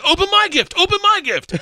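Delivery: loud, high-pitched chant, punching each word; eager pestering. Retold or imitated. Comic retelling of himself pestering her all Christmas, excited and loud.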